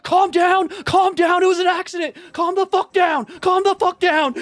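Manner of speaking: screamed high and loud, losing it; furious yelling. Retold or imitated. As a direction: yell it at the top of your lungs, flipping the blame. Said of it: "Moist Critical just starts yelling".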